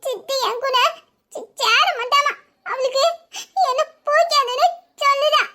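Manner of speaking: high, sobbing complaint; broken, drawn-out tearful pleading; crying child. Acted. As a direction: tell on someone through sobs. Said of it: "ஏன் அழுவுற இப்படி?".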